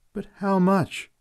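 high, pleading lover's question; passionately. A narrator's reading. Fritz pressing Elsa passionately, lightly voiced. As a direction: ask it with lovesick urgency.

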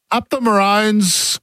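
slow, forced, grudging cheer; through gritted teeth. Pretend. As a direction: cheer it like it hurts. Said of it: "through gritted teeth".

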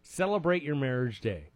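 ordinary talk

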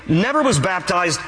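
normal talking voice

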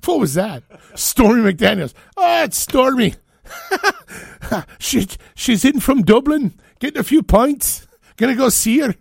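hammed-up Irish accent, very high and loud; terrible Irish accent. Host joking about Stormy from Dublin, terrible Irish accent. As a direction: shout it out in a badly faked Irish brogue. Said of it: "That's my most terrible Irish".

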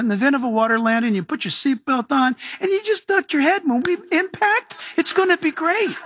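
high, breezy mock attendant promising all is fine; overconfident flight attendant. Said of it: "flight attendants are so confident".

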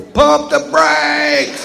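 very high, drawn-out holler to the driver; high-pitched comic holler; mock holler. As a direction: call out high and slow, stretching the words. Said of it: "I can just holler at that driver".

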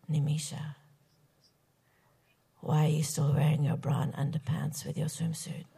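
low, murmured mock whisper; retold whisper. Boy leaning in at a swim party, imitated whisper. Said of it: "he whispers in my ear".

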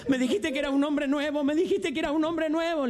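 high, whiny wife complaint; reproachful wife. Acted. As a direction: whine at him like a wife. Wife reproaches drunk husband.

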